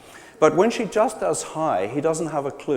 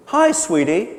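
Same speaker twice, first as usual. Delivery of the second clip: bright, raised loving hi; affectionate greeting; 5 semitones higher, 5 dB louder than usual. Shown as a demonstration. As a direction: greet a partner fondly. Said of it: "she doesn't do the endearment".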